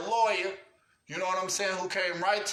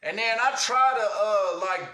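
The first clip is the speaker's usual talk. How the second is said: ordinary talk, nothing marked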